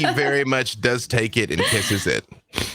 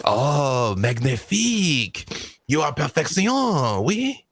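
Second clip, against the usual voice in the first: French admiration; 3 semitones higher than usual. Acted. French NPC admires Oz's arm.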